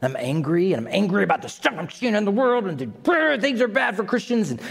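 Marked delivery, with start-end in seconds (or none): none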